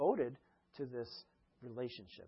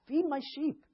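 much higher, urgent repeat; emphatic command; 11 semitones higher than usual. Retold or imitated. Jesus urging Peter to feed his sheep, emphatic. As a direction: press the charge home.

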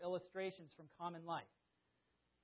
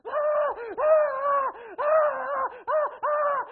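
high, very loud bursts of screaming; hammed-up hysterical screams, one after another; repeated screaming; 21 dB louder, much slower than usual. Preacher reliving dancing round the kitchen, repeated hysterical screams. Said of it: "I kept screaming".

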